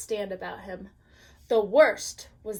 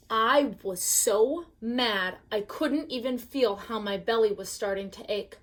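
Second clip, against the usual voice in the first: ordinary delivery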